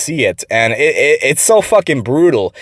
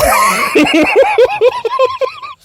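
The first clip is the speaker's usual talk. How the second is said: very high laughing squeal; high real laugh; real laughing shriek. Real, not acted. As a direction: howl with laughter. Laughing at the quote.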